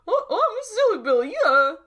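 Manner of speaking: high, fast goofy voice; silly voice. Acted. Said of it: "upsetting to hear that voice coming from you".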